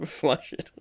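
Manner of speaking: words wheezed through a laugh. Real, not acted. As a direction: repeat it helplessly as you laugh. Still laughing at the joke.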